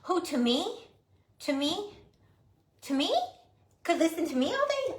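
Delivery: flattered high squeak; high delighted reading of a chat comment; surprised. Real, not acted. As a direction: pitch up in delighted disbelief. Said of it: "My Minnie Mouse voice".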